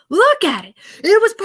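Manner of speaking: high, excited showing off the car; a proud car owner's loud, high boast. Retold or imitated. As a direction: lift it up high, look at my car. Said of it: "he's bringing up the car and he goes".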